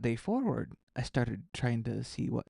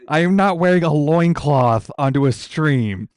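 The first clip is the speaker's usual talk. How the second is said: loud protest; 4 semitones higher, 13 dB louder than usual. Real, not acted. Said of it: "Oh my gosh".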